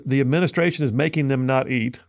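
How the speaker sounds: ordinary delivery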